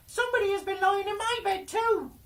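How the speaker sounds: calling out. Acted. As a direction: shout it up high like startled dwarves. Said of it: "each called out".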